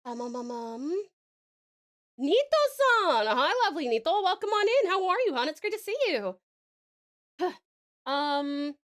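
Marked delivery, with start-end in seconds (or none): 2.2-6.3 loud, high welcoming call; excited greeting. Real, not acted. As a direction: welcome a friend warmly.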